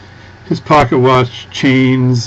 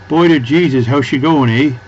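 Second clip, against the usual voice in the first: put-on lively Newfoundland accent, a bit louder; 4 semitones higher, 4 dB louder than usual. Vlogger imitating how Newfoundland Canadians greet you, lively Newfoundland accent. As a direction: sing it out like a Newfoundlander. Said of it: "in the Newfoundland area, they go".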